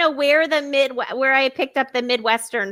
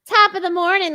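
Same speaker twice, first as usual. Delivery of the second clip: higher, a hint of a stage Irish accent; mock Irish greeting; 7 semitones higher than usual. Teasing Irish greeting to chat viewer, light Irish accent. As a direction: toss off an Irish top of the morning lightly. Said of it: "attempt an Irish accent".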